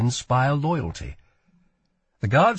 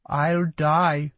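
put-on higher boy's voice, resigned; sad certainty; 5 semitones higher than usual. A narrator's reading. Boy guard answers Lila's threat, sad certainty. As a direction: state it sadly as simple fact. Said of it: "with sad and equal certainty".

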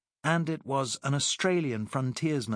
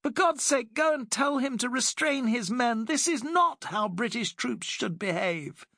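urgent Alice; 12 semitones higher than usual. Acted. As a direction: press him urgently to act. Alice urges Simon, outraged.

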